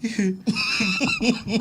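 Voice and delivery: a squealing laugh breaking out; high burst of laughter. Real, not acted. Laughing to her friend at her own Spanish.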